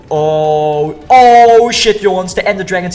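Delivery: loud high-pitched scared shout; startled yell. Real, not acted. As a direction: yelp in sudden fright. Sees the Ender Dragon.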